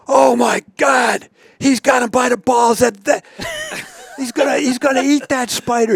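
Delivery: high, loud, cracking mock yell with laughter; really going. Ted watching the lizard chomp the spider, high loud laughing yell. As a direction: shriek it like a man watching a lizard grab its prey. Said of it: "now he's really going".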